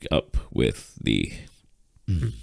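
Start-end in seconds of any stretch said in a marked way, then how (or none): none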